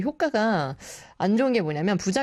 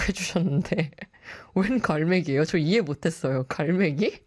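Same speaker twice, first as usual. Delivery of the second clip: ordinary delivery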